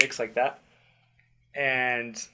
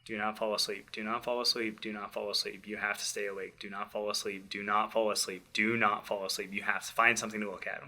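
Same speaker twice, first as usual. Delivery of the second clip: softer, low urgent self-talk; inner self-talk; 3 semitones lower, 8 dB quieter than usual. Retold or imitated. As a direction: talk yourself into staying awake, under the breath. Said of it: "and I'm like".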